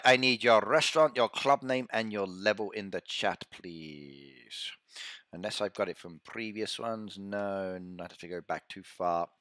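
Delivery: ordinary talk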